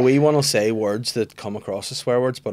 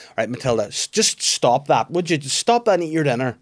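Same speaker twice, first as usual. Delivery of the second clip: telling off; 4 semitones higher, 5 dB louder than usual. Retold or imitated. Parent firmly telling daughter to eat dinner. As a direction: stop her firmly at dinner. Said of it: "I was like".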